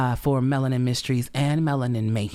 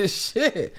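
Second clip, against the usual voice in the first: high, giggling through the words; laughing at herself; 11 semitones higher than usual. Real, not acted. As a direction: crack up at your own joke. Laughs at own rundown joke.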